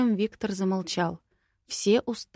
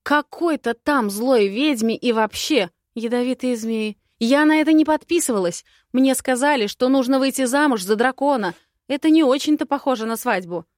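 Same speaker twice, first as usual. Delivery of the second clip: panicked rant; 6 semitones higher, 7 dB louder than usual. Acted. Frightened heroine rants.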